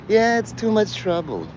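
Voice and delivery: mock picky refuser; a lightly voiced fussy complainer; whiny complainer. Acted whiny refugee reply.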